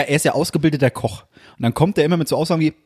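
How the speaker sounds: normal talking voice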